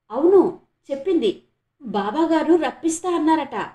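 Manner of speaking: loud, high, bursting with news; excited. Acted. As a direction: blurt it out, eyes shining. Girl blurts out her secret news.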